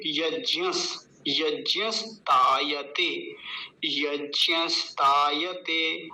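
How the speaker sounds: यज्ञस्तायते slowly as yagyas-taa-ya-te, long ता; स joined on. Shown as a demonstration. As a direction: join स to यज्ञ, pause, then stretch तायते. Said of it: "इसमें स और जोड़ेंगे". (यज्ञस्तायते में स जोड़कर, धीरे)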